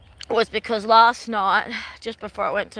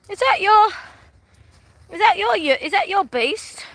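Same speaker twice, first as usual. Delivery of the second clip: imitating a man; 11 semitones higher, 5 dB louder than usual. Man at rehearsal asking about her car, mock bloke voice. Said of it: "this man says to me".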